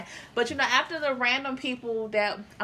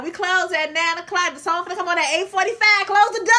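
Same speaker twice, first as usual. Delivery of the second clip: rising, louder urgent call to close up; mock urgent closing call; 6 semitones higher, 5 dB louder than usual. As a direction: hurry everyone out as the song starts. Retelling closing-time rush.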